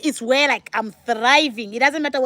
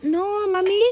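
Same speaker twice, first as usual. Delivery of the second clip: put-on high-pitched little kid quote; lightly mimicked child; imitating own child; 8 semitones higher, slower than usual. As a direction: voice your child gently correcting you, higher. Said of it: "my baby told me, like".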